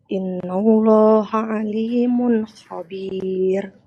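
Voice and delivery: ordinary delivery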